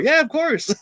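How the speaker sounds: high-pitched, mock-eager yes; eager agreement. Channel members allowing bad singing, eager agreement. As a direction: imitate them cheerfully giving the go-ahead.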